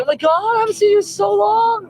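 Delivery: very high squealed dog voice; overjoyed dog. Acted. Dog greeting a long-missed person, high excited voice. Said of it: "He's like".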